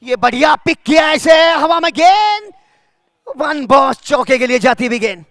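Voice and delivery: loud, high shout on the shot; excited cricket commentary. Real, not acted. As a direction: follow the ball, shouting.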